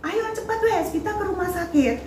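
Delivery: high urgent call, volume held back; retold yell. Mother calls Papi to hospital, retold high yell. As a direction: imitate calling out urgently to a husband, pitched high. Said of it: "saya teriak ke papinya".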